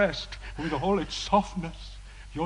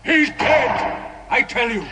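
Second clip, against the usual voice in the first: much higher, shouted HE'S dead; shouted insistence; 7 semitones higher than usual. Acted. Priest shouting to the prisoner that her love is dead.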